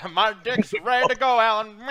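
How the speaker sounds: put-on a Southern good ol' boy voice; southern accent. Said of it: "do the southern accent".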